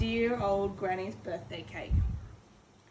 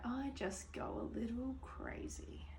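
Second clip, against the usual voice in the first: confiding whisper; 16 dB quieter than usual. Acted. Wolf confessing his temper to the listener, whispered. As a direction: lean in and breathe it like a secret.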